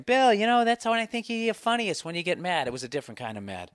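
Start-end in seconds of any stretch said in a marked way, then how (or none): 0.0-2.7 high, loud mock fan voice; imagined fan. Host voicing a listener who likes him mad, mock fan. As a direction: chirp it like an eager listener. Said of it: "I know what you're thinking".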